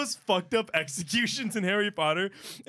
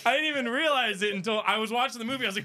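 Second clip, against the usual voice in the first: ordinary talk, nothing marked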